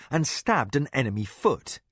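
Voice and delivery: ordinary delivery, nothing marked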